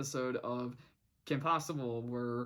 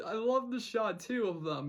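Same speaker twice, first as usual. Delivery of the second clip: ordinary talk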